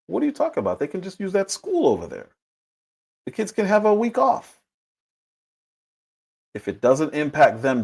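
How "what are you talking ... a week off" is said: higher, dismissive mock of a snob; mocking elitist voice. Host mocking rich elitists dismissing homeless shelters nearby, higher sneering voice. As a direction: brush it off like a smug elitist.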